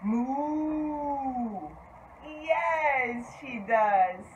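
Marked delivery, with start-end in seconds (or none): 0.0-1.7 long drawn-out cow moo; one held, steady moo; cow's moo. Shown as a demonstration. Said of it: "Does the cow say".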